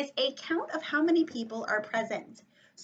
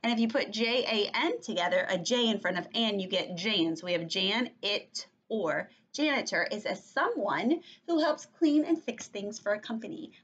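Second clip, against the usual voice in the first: ordinary talk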